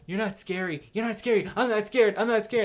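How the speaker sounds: lightly acted, high-pitched denial; mock protesting. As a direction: repeat it insistently, mimicking someone in denial. Mimics guests denying they are scared.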